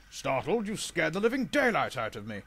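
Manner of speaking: loud, indignant retort; blunt. Acted. Gordon answers the Duke's apology, blunt and loud. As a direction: throw the word back bluntly. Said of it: "replied Gordon bluntly".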